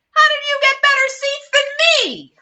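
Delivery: put-on a bossy girl demanding an answer; indignant Lucy. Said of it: "Lucy yells".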